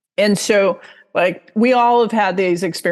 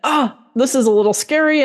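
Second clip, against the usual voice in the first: higher, quick, lightly acted nervousness; mildly scared; 6 semitones higher, faster than usual. Teacher voicing someone finding it a little scary, mildly nervous. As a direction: pipe up with a touch of fright.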